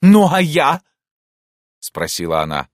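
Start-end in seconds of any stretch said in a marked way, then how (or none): 0.0-0.8 high, loud, demanding question; angry challenge. Acted. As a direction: demand an answer indignantly. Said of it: "довольно жёстко и гневно отозвалась". (требовательно и гневно)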